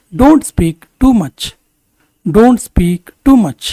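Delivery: slow, measured English for learners; slow model sentence. Shown as a demonstration. Teacher models English for Kannada learners, slowly and deliberately. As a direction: say it slowly with spaced words.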